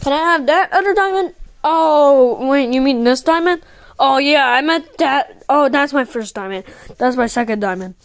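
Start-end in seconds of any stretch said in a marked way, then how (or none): none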